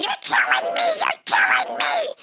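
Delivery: very high-pitched mock wail; retold crying scream. Hurt woman to the boat crew, screaming wail. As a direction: wail it in hammed-up agony. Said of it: "and I scream, and I cry".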